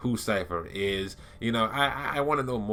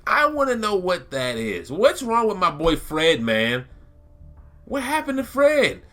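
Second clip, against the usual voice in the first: high excited whine; 10 semitones higher, 7 dB louder than usual. Real, not acted. Fan wails to viewers about Omen's lost identity, high and loud. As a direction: voice jumps way up and louder, half wailing about Fred. Lamenting a favourite character's fate.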